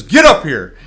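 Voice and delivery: loud, firm command; preacher summoning the convert to the platform, loud; loud summons. Retold or imitated. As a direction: order him up front.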